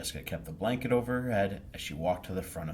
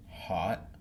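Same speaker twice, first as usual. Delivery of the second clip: ordinary delivery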